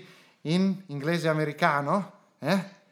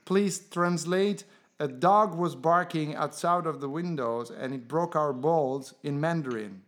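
slow, clear command; slower than usual. Acted.

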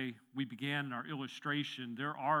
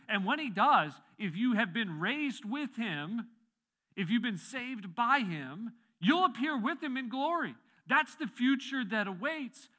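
high, loud pulpit proclamation with slow, stretched phrases; impassioned preaching; 7 semitones higher, 6 dB louder than usual. Real, not acted. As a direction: proclaim it to the whole congregation, voice lifted. Preaches at full force.

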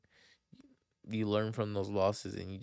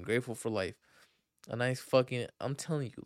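ordinary talk, nothing marked

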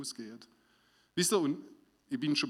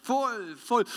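fervent prayer; 6 semitones higher, 3 dB louder, slower than usual. Shown as a demonstration. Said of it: "Und mit dir".

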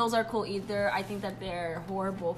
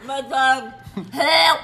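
higher, louder pleading mother voice; frantic mother; 5 semitones higher, 9 dB louder, much slower than usual. Pretend. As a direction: cry out like a desperate mom. Playing a frantic mother on phone.

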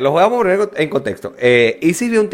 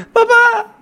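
mock crying daughter; 3 dB louder than usual. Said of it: "la carajita llorando empieza".

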